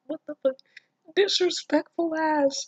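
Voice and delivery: high, incredulous disbelief; outraged. Real, not acted. Reader outraged at the blade wiped on her shirt. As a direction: react in shocked outrage.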